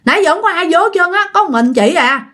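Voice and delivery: acted-out emphatic denial; insistent. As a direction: insist you're telling the truth. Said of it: "nhỏ này nó khẳng định".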